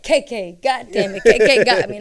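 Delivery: loud acted cursing; exasperated outburst. Barber finding the short money, loudly exasperated. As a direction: blurt it out like you've just been cheated. Acts out discovering an unpaid cut.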